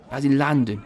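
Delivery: lightly imitating a Cockney London accent. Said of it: "the stereotypical, uh, London accent".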